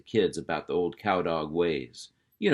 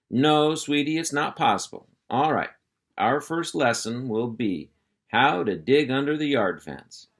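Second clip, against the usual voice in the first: louder, higher, taking charge; hearty uncle voice; 6 semitones higher, 4 dB louder than usual. Acted. Uncle Hank calling the puppies to attention, hearty and loud. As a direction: round up the kids like a ranch boss. Hank starts the first lesson.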